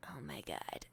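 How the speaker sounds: whispering in embarrassment at the table; whispered dread. Retold or imitated. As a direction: mutter it in horror under your breath. Put on the spot about beef.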